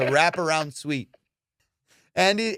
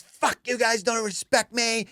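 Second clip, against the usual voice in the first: high, drawn-out mock indignation; wounded outrage; 6 semitones higher, slower than usual. Drugged friend berating pals before throwing a glass, high wounded outrage. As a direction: imitate an aggrieved drunk, pitched up.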